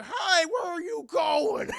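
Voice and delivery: high, loud, slow shouted taunt; mock monster taunt. Creature yelling at the escaping helicopter, slow loud taunt. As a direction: call after them like a giant cheated monster. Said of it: "the fucking creature's like".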